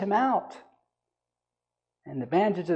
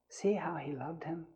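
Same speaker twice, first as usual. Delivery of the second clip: whispery, much softer reading; hushed repeat; 12 dB quieter than usual. Shown as a demonstration. Preacher repeats the verse to the congregation, hushed. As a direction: let the verse drop to a near whisper.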